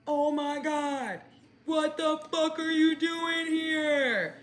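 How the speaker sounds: high, slow, singsong "what are you doing here"; drawn-out fake-shocked friend; mock surprised greeting.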